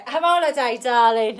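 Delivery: cheery holiday nudge; playful urging. Acted. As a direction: egg them on playfully.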